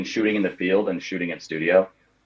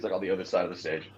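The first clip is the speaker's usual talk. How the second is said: usual speaking voice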